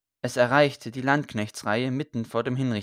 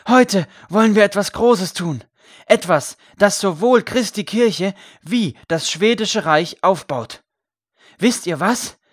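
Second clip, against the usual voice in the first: loud, high public proclamation; calling out; 8 semitones higher, 9 dB louder than usual. A narrator's reading. Priest announces a great deed to the people, loud proclaiming call. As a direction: proclaim it forcefully to a whole crowd. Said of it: "rief er".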